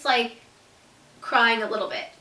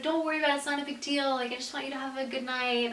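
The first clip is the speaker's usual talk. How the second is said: soft, gentle reassurance; imitating a softly comforting partner; sweet; 2 semitones higher, 7 dB quieter, faster than usual. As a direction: soothe them quietly, as a kind boyfriend would. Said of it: "he was just so sweet about it".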